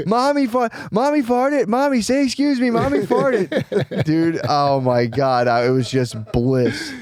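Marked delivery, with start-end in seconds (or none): 0.1-4.0 very high-pitched little-kid impression; Maya. Little daughter announcing on the plane that mommy farted, mimicked child voice. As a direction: pipe up like a small child insisting on manners. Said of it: "I knew she would go".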